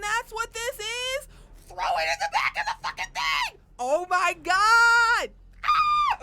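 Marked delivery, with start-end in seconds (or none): 1.7-5.3 exasperated yell. Real, not acted. Streamer yelling at the game. 5.6-6.2 shocked shriek. Real, not acted. Streamer screams in shock.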